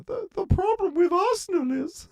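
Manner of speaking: high hammed-up Irish accent; squeaky comic Irish accent. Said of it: "trying to do an Irish accent".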